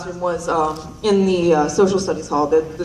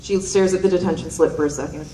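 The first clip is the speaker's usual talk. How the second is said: normal talking voice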